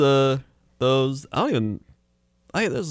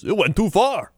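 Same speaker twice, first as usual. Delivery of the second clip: higher punched Chicago ad voice; Chicago guy; 10 semitones higher than usual. Retold or imitated. Comic mimicking a radio commercial guy, high Chicago accent. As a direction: deliver the catchphrase in mock Chicago. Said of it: "a Chicago guy".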